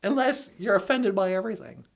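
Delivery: ordinary talk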